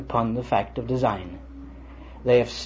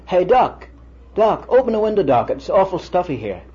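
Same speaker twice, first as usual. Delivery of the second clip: retold loud call, pitched higher; calling out loudly; 5 semitones higher, 3 dB louder than usual. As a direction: imitate a patient calling for the doctor. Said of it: "he called out loudly".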